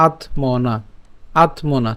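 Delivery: "আত্মনা" sounded separately with a clear t before m; word-part demonstration. Shown as a demonstration. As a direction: say "আত্মনা" as its own piece, t and m both sounded. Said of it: "এবং বাকিটা উচ্চারণ করব হচ্ছে".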